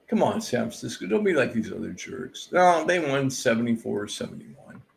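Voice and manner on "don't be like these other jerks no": normal talking voice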